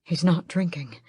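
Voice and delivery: plain reading voice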